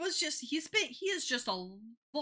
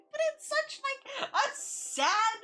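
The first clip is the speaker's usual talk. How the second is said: laughing; 13 semitones higher, slower than usual. Real, not acted. Laughing at sad scene.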